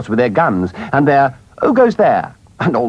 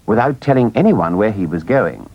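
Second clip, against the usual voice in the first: ordinary delivery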